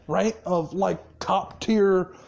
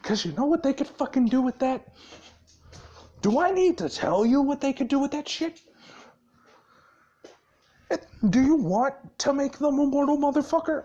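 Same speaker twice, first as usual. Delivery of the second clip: higher, fast, incredulous questions; mock tirade, pitch climbing on each question; acted angry berating; 6 semitones higher, faster than usual. Boss berating the Pox over human contact, acted angry berating.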